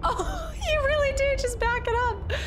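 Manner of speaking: genuinely surprised by the game; surprised delight. Real, not acted.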